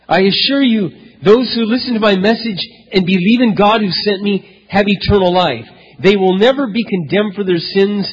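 loud, lifted scripture proclamation; preaching the promise out loud; full-force preaching. Acted. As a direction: proclaim Jesus's words to the hall. Preacher quoting Jesus at full force.

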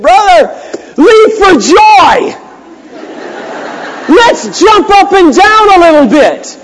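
loud, high, drawn-out rallying cry; exultant shout. Retold or imitated. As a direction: shout it out like a celebration. Joyful rallying reply to discouraged friend.

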